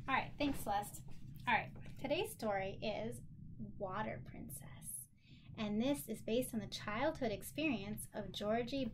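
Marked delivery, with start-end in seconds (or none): 3.8-5.1 hushed whisper of the title. Real, not acted. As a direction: breathe the title softly.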